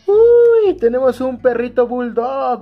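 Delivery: very high loud "uy" then a high bright announcement; can't contain the thrill; excited yelp. Real, not acted. Vlogger unveiling a bulldog figure, excited yelp.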